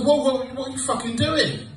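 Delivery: high, loud panicked yell; alarmed shout. Retold or imitated. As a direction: stop her before she swallows it. Retold panic at girlfriend eating mincemeat.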